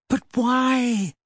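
high, plaintive question; dismayed girl's high wail, kept small; close to crying. A narrator's reading. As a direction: ask it as if tears are coming. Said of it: "sounded as though she wanted to cry".

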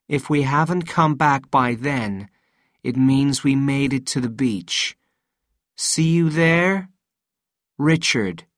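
measured, cold read-out. A narrator's reading. Sal reading the note to Richard, in a cold voice. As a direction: read the note out coldly and deliberately. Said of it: "she read in a cold voice".

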